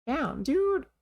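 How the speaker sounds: high-pitched stunned dismay; shocked damn. Real, not acted. Gamer hearing Pearl's loss, high stunned cry. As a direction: cry it out, struck by the sadness. Reacting to sad backstory.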